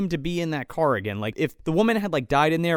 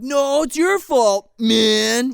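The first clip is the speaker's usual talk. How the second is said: mock stoner drawl; 6 semitones higher, 4 dB louder, much slower than usual. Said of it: "and he's like".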